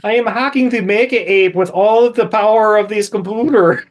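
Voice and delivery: put-on high, hammy Russian hacker accent; mock bad-actress Russian accent; Russian-Wisconsin accent. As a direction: declaim it in a badly mangled Russian accent. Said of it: "has a Russian accent by way of Wisconsin".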